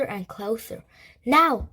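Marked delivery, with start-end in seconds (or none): 1.3-1.7 loud, high urgent call; shouted command. A narrator's reading. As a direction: shout the signal to move. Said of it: "shouted Danny".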